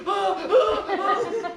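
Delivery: high, loud mock yelps of panic; mock panicked cries. Guide mimics a squealing tourist, mock panicked yelps. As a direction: imitate a tourist squealing as the gator thrashes. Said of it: "You're like".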